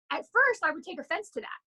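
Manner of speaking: normal talking voice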